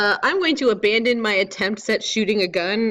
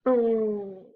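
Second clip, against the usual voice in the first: ordinary delivery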